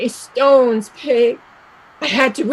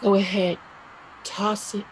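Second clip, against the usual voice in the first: quiet, much softer coaxing; soft urging; 4 semitones lower, 7 dB quieter than usual. Acted. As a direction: coax her gently. Urging Peg, first time.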